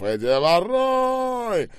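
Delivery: long, high-pitched yell; shouting. A narrator's reading. Скоба cutting into Ребров's announcement, shouting. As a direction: stretch it into a loud high cry. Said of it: "закричал Скоба".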